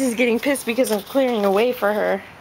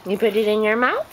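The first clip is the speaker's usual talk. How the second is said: usual speaking voice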